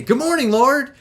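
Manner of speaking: high, lively wake-up greeting; bounder's cheery greeting. Acted. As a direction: bound out of bed greeting the day. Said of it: "bounders wake up and they say".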